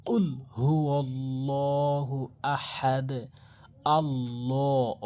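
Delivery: slow chanted Quran verse; recitation. Shown as a demonstration. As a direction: recite the verse slowly, drawn out.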